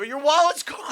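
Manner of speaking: high, loud, laughing mimicry of panic; mock alarm. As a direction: imitate a partner's alarmed yelp. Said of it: "You go".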